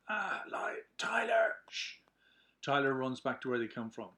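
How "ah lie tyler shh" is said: whispered hush to keep hidden; urgent whisper. Acted. Jeff hushes while hiding from cops.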